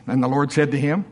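ordinary talk, nothing marked

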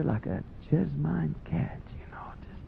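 hushed aside; whisper. Real, not acted. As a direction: lean in and whisper it. Whispered aside about grinning.

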